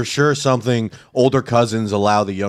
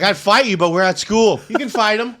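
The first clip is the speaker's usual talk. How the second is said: high, teasing teacher voice egging them on; lightly voiced lazy teacher; playful high teacher cheering a fight; mock teacher; 9 semitones higher, 4 dB louder than usual.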